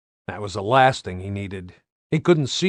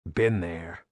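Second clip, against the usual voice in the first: quiet inner thought; 6 semitones lower, 6 dB quieter than usual. A narrator's reading.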